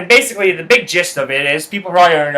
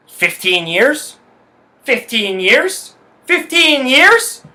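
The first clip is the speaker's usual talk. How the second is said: incredulous; 7 semitones higher, much slower than usual. Acted. Repeats years of friendship, incredulous.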